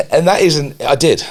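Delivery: laughing through the words, slowed down; a real laugh while admitting it. Real, not acted. Laughing about choking on cornflakes.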